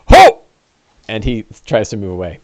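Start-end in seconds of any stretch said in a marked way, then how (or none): 0.1-0.4 much higher, louder sudden yelp; startled yelp. Acted. Fleeing NPC startled by the chaser, startled yelp. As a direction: jump at being caught up to. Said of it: "He's like".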